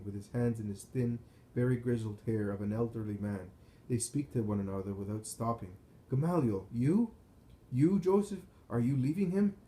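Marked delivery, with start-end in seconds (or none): 6.1-9.5 higher, louder questions of disbelief; astonished, pitch leaping up on the questions; startled. A narrator's reading. Men meeting unexpectedly on the run, startled.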